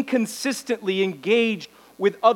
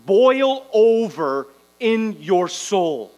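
drawn-out boil over in your soul; slow emphatic; much slower than usual. Real, not acted. Preacher urges fervor, slow drawn-out emphasis. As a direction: stretch each word to boil over.

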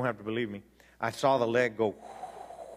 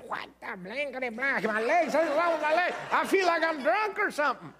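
much higher, panicked bewildered cry; alarmed; 12 semitones higher than usual. Retold or imitated. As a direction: retell the panic at his legs, pitched high. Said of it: "I told him, I said".

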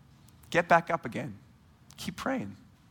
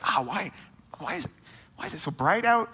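higher, whiny mock complaint at the morning light; groggy whine; 8 semitones higher than usual. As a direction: whine like you just woke up.

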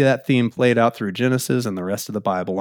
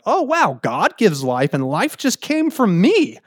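high, awestruck new-mother exclamation; amazed; 6 semitones higher than usual. Acted. Imagined Eve marveling at her first birth, amazed. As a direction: marvel aloud with pitch leaping up. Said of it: "imagine Eve being like".

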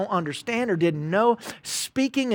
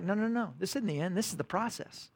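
much softer, calm reassurance; gentle God; 11 dB quieter than usual. Acted. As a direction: hush it, like soothing a worried child. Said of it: "And God's like".

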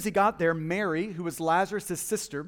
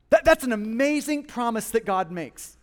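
ordinary delivery

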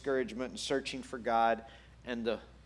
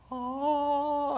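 long, high, loud "oh"; acted moment of revelation; dawning realisation; 13 semitones higher, 5 dB louder than usual. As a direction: draw out a high oh as it clicks. Acting out a sudden realisation.

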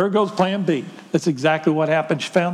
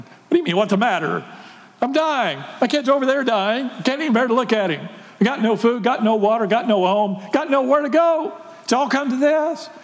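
desperate Hagar; 6 semitones higher than usual. Acted. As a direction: pour out a desperate complaint, pitched up and pleading. Desperate Hagar answers the angel.

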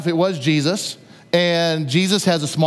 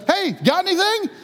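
high, far-off call across the water; calling from shore; 12 semitones higher than usual. Retold or imitated. Stranger on the shore calling out to fishermen, high hail. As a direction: hail the boat from the beach. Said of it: "somebody on the shore yells at them".

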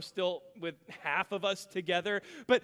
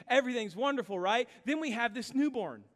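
ordinary talk

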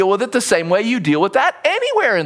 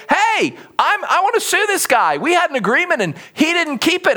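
higher-pitched, mildly indignant complaint; indignant complainant; 8 semitones higher than usual. Acted. Man suing a friend at the courts, mildly indignant. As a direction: lodge a complaint in a raised pitch. Acting out an angry litigant in court.

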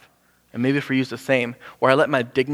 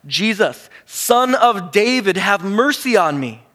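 high, loud, slow cry for mercy; desperate pleading shout; retold shout; 7 semitones higher, 5 dB louder, slower than usual. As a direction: call out across a crowd, drawn out. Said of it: "he began to shout".

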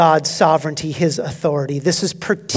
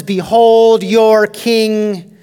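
slow, loud sarcastic proclamation; mock the crowd as he presents him, drawn out; 4 semitones higher, 7 dB louder, much slower than usual. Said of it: "it's sarcastic".

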